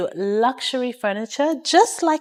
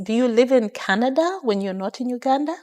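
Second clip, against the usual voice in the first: usual speaking voice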